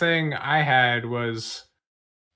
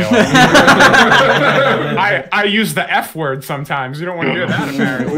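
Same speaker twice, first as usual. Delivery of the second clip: laughing sarcasm; 5 semitones higher, 9 dB louder than usual. Real, not acted. Laughing sarcasm about ban.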